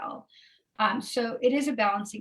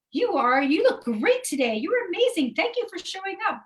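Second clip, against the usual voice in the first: higher, over-sweet compliments; sugary sweet; 9 semitones higher, 5 dB louder than usual. Pretend. A character flattering someone they secretly resent, sugary. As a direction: gush the praise a bit too sweetly. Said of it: "might be saying the nicest thing".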